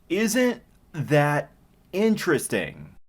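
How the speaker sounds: slow, spaced-out mock intrigue; pointed sarcasm. Real, not acted. Reviewer hinting at self-insert writing, slow sarcasm. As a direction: draw each word out knowingly.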